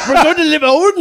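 imitating a Southern twang. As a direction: parody his slip into a Southern twang. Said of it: "about three accents in there".

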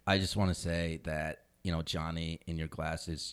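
soft, low mock-sincere tribute; mock tender.

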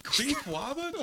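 Wabbits with a W, high and slow; high, slow mocking of Wabbits with a W; mock speech impediment. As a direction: repeat Queef Wabbits slowly in a high put-on voice. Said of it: "why are you saying like that".

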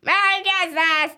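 put-on little boy doing an elf voice; child's elf voice. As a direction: say it in his best elf voice. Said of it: "He changed his voice".

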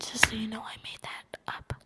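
hushed confession under the story; whispered aside. Pretend. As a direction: drop to a near-voiceless whisper. Whispered aside during the show.

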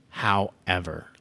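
ordinary talk, nothing marked

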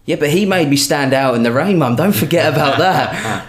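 high, loud whiny excuse; whiny kid protest. Retold or imitated. Jake protests to mum, high and whiny. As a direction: plead your case like a caught kid.

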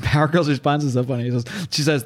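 ordinary delivery

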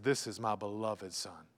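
soft, low, unhurried reverence; tender, solemn. Acted. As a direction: voice God's quiet affection, low and hushed. Voicing God looking down on his Son.

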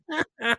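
high cartoon cackle laugh; Roger Klotz laugh. Shown as a demonstration. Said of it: "practicing my Roger Klotz laugh impression".

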